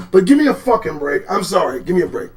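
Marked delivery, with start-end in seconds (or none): none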